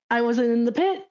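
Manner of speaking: ordinary talk, nothing marked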